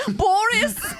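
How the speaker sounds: put-on high, loud Russian-accented call; Natasha impression. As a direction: call out like a cartoon spy vamp. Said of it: "like Natasha from Bullwinkle".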